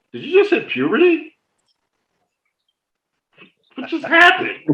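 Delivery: high incredulous teasing; mocking disbelief. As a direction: poke fun at the squeaky voice in disbelief. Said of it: "I'm like".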